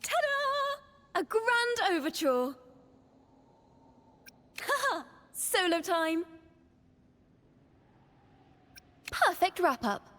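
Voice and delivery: high, triumphant showman calls; showy excitement. Acted. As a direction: announce it with a flourish. Bard's triumphant performance calls.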